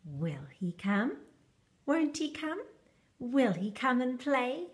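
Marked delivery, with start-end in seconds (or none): none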